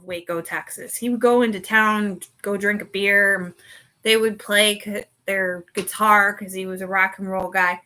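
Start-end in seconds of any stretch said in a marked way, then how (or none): none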